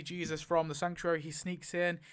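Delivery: normal talking voice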